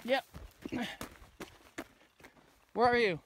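normal talking voice

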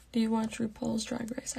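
normal talking voice

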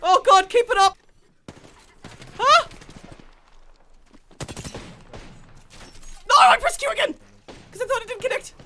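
high panicked yelling; frantic shouting. Real, not acted. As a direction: yell it in a gaming panic.

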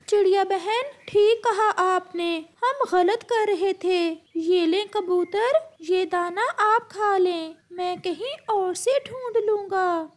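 high-pitched, meek parrot giving in; a high little parrot saying sorry. Acted. Parrot apologises to the bird.

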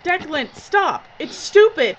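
screaming at him to stop; rising into a frantic yell, pleading; desperate shout. Acted. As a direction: cry out to stop a friend's suicide run. Pleading with Declan not to crash.

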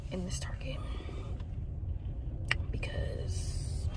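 hushed unvoiced whisper. Real, not acted. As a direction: whisper it so no one hears. Whispering near ex-friend's house.

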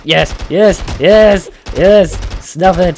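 high, loud cheering yes; triumphant yells. Real, not acted. Player cheering loudly as he wins the duel. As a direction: shout in triumph at the kill. Winning the fight back.

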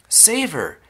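high-pitched plea; desperate cry. A narrator's reading. Mother begging for her daughter, crying out. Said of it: "cried Missus Beauregard".

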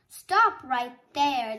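loud, slow troll command; booming storybook troll order; troll's roar. A narrator's reading. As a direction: roar each word out slowly. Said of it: "the troll roared".

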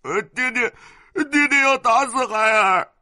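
put-on high, loud wailing little boy; child sobbing high and loud; crying child. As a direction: wail to mother for help. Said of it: "在母亲面前哭道".

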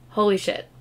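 usual speaking voice

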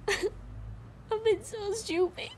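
soft, slow, crying whisper; tearful whisper. Acted. Woman whispering remorse beside her partner. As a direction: hush through tears.

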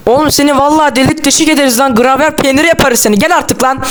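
mock-furious yell at a friend; comic threat. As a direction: threaten him like a cartoon thug. Mock threat to kill Melon.

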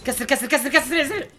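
high, rapid-fire repeated order; urgent command. Real, not acted. Player orders squad to break in fast, urgent chanting. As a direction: drive the team on with repeats.

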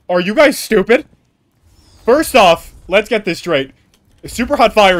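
loud, high worked-up rant; heated rant. Real, not acted. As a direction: vent loudly at the viewers. Heated rant about rap battle.

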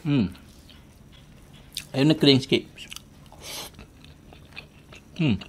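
speaking between bites; chewing while talking. Real, not acted. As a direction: mouth partly full of food. Seen 4: He keeps chewing, puts food in mouth at 2.8-3.1 s, lips working.

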